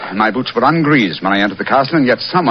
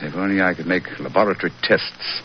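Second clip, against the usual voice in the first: ordinary talk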